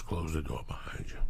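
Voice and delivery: hushed, secretive whisper. Retold or imitated. Dealer whispering to me in his bedroom, hushed whisper.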